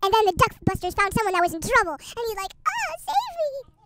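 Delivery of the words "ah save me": high, slow, hammed-up plea; mock cry for help. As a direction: wail for rescue like a cartoon victim. Someone trapped calls for help.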